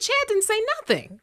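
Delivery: can't believe what you heard; incredulous. Retold or imitated. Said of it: "I said".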